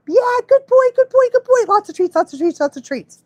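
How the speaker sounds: high, loud gushing praise fading down; dog praise baby talk. Shown as a demonstration. Trainer praising a puppy for peeing outside, high and gushing. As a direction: squeal praise at the good boy.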